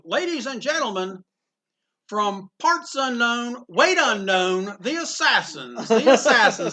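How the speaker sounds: drawn-out ring introduction; wrestling announcer. Retold or imitated. Ring announcer introducing wrestlers to the crowd, imitated with relish. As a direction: announce the tag team with slow, stretched words. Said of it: "they used to go".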